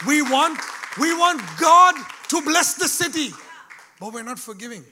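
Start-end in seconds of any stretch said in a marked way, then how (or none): 0.1-3.3 loud, high preaching cry; preaching shout. Real, not acted. Preacher shouting to the congregation about blessing the city, loud. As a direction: lift it up and shout it to the church.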